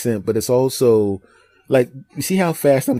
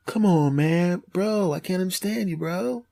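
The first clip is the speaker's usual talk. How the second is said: higher, softer Californian bro voice; laid-back Californian; 7 semitones higher, 4 dB quieter than usual. Acted. Californian bro baffled by a fast talker, laid-back whine. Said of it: "we're laid back here".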